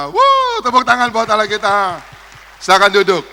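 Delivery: loud, high calls to the congregation; lively worship leading. Real, not acted. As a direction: rouse the whole church to cheer and clap. Leads congregation in loud praise. (memimpin sorak pujian dengan suara keras dan tinggi)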